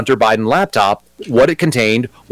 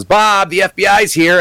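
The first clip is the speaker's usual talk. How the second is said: higher, slow call into the house; 8 semitones higher, slower than usual. Retold or imitated. Wife at the door calls her husband Bob, calling out. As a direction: sing out his name to fetch him.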